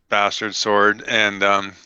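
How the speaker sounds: ordinary talk, nothing marked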